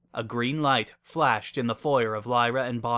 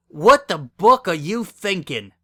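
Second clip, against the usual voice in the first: loud, higher, slower outburst; exclaiming; 7 semitones higher, 9 dB louder, slower than usual. A narrator's reading. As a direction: blurt it out in shocked outrage. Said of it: "Bonbon exclaimed".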